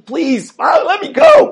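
put-on high, desperate pleading cry of a child; pleading scream. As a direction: beg your father to stop, voice cracking high. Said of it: "the son is screaming".

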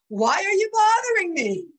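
high, loud mock accusation; accusing, as misheard. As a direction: imitate an irritated outburst, pitched right up. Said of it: "But all my husband heard was".

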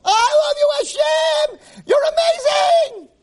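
very high, loud, stretched scream of love; screaming at the top of lungs. Retold or imitated. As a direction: scream it to the sky with joy. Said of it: "screaming at the top of my lungs".

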